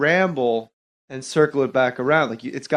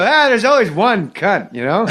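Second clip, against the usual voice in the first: Norm impression; 5 semitones higher, 5 dB louder than usual. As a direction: shoot back at the heckler as Norm. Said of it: "I did it in the impression".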